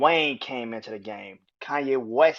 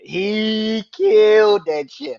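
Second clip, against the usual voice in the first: high, loud, slow hype; excited; 9 semitones higher, 9 dB louder, much slower than usual. Real, not acted. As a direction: stretch it out in awe. Raving about the verse.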